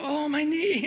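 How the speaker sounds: put-on high, loud little kid wail; mock kid whining over a scraped knee; whiny child. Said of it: "they go".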